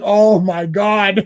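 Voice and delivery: slow drawn-out oh my god; dismayed groan. Retold or imitated. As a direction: let it sink in slowly. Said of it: "He's like".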